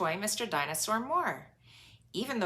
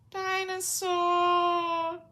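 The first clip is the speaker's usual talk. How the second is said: slow, high crying wail; toddler wail; 13 semitones higher, 6 dB louder, much slower than usual. Acted. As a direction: howl it like a lost toy. George wailing for his toy.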